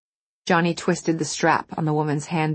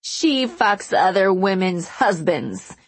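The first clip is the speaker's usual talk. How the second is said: raised, slow accusation; angry yell; 4 semitones higher, slower than usual. A narrator's reading. Wronged wife accusing a showgirl in public, slow angry yell. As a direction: announce the affair in battle mode. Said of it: "the woman yelled".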